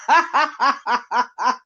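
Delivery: a short real laugh at the gossip. Real, not acted. Host cracking up over the drama, laughing.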